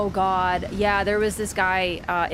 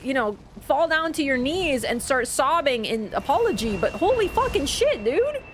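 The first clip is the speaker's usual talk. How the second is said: higher, rising in disbelief toward dude; exasperated scolding; 5 semitones higher than usual. Retold or imitated. Retelling scolding the man who spilled on her, incredulous. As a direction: call him out in incredulous outrage.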